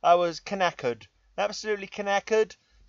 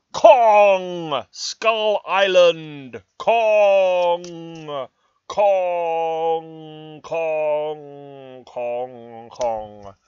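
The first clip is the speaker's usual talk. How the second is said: booming movie-trailer call, fading away like an echo; loud mock trailer shout that dies away; 7 dB louder, much slower than usual. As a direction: bellow the title then let it echo off into the distance. Movie title bellowed, echo fading.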